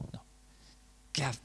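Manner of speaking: ordinary talk, nothing marked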